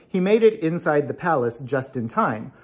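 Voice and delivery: plain reading voice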